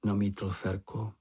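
usual speaking voice

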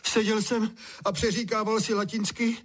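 high-pitched lament; wailing. A narrator's reading. Drenched Honza tells father what hit him, wailing. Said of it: "bědoval Honza".

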